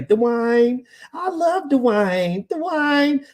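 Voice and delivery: exaggerated country accent, high and drawn out; Whitley Gilbert. Sitcom character declaring her love for DeWine, syrupy country accent. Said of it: "even her country accent".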